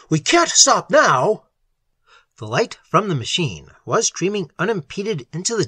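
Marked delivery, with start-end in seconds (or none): 0.1-1.5 high, loud, drawn-out protest; sharp with alarm. A narrator's reading. Little refusing to stop the chase, sharp with alarm. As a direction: insist loudly, stretching the words. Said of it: "his voice sharp with alarm".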